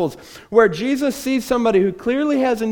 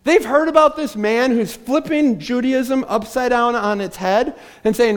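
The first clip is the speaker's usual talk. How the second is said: ordinary delivery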